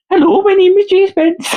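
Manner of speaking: very high-pitched, put-on Geordie; mock Geordie accent. Man jokingly introduces himself to women, high mock Geordie accent. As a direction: squeak out a comic Geordie introduction. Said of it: "He's Geordie".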